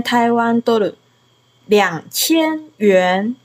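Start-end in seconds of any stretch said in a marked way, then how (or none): 1.7-3.3 两千元 drawn out slowly, syllable by syllable; slow model pronunciation. Shown as a demonstration. Teacher giving a learner Chinese to repeat, slow and measured.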